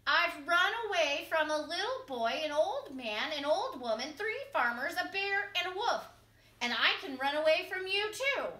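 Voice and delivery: high, loud boastful call. A narrator's reading. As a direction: shout the boast at the fox. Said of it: "he called out".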